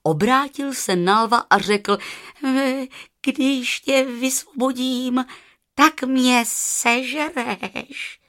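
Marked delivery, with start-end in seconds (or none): 2.5-8.2 high, slow, hesitant jackal voice; timid little jackal, pitched up; worried jackal. Acted. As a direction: draw it out high and doubtful.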